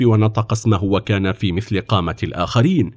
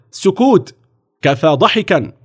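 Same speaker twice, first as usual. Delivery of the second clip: higher-pitched sharp command; officer's shout; 8 semitones higher, slower than usual. A narrator's reading. Officer silencing the laughing children, calling out. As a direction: call for silence with authority. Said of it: "وهتف الضابط".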